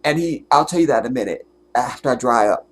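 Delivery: ordinary talk, nothing marked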